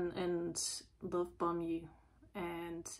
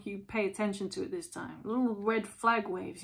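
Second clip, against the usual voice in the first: ordinary talk, nothing marked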